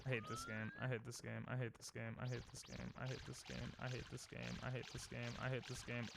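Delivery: very quiet, low, monotone chant; defeated muttering. Real, not acted. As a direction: mutter it over and over under your breath. Defeated repeated mantra after scream.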